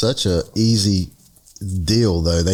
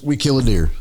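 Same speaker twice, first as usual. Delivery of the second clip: ordinary delivery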